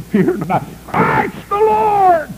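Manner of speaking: high, slow, ringing cry of the angel choir; heavenly proclamation. Acted. Choir of angels announcing to the shepherds, slow ringing cry.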